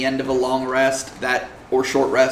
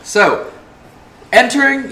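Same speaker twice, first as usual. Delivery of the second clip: ordinary talk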